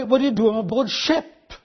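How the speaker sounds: ordinary talk, nothing marked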